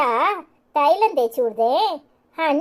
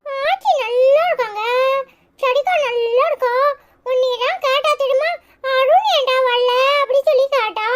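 put-on high-pitched little kid brother voice; little brother, eager; 11 semitones higher than usual. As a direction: chatter up high like a small boy telling his big brother. (உச்ச குரலில் பேசும் குட்டித் தம்பி)

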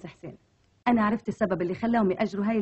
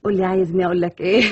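lively Egyptian Arabic, a touch louder; Egyptian Arabic accent; 6 dB louder, faster than usual. Shown as a demonstration. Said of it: "طب تقدري تكلميني بالمصري؟".

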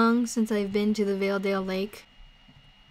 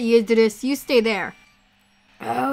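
ordinary delivery

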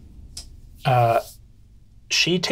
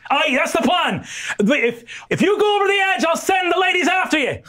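captain's shouted threat; 14 semitones higher than usual. Acted. GM voices Annabelle threatening.

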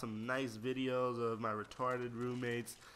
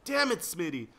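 sudden higher, louder cry of annoyance; frustrated gamer yell; exasperated outburst; 5 semitones higher, 6 dB louder than usual. Real, not acted. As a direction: curse out the player who got you. Outburst at player who killed him.

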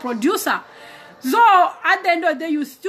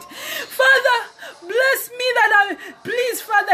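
high, whining mock-tearful plea; pleading prayer; 9 semitones higher, slower than usual. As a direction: beg like a child near tears. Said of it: "Sometimes we pray that".

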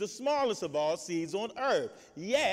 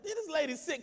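ordinary talk

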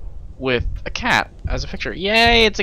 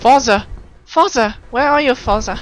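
calling out; 11 semitones higher, 6 dB louder than usual. Real, not acted. Calling out for someone.

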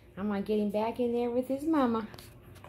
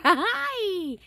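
laughing hi; 9 semitones higher, 5 dB louder than usual. Real, not acted. Greeting puppy with laugh.

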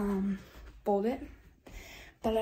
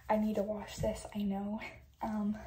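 ordinary delivery

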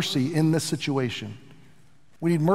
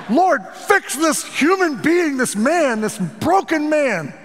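much higher, slow mock voice of the wife; wife's exasperated prayer; 9 semitones higher, 4 dB louder, slower than usual. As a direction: play the fed-up wife praying against him. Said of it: "she wants to pray".

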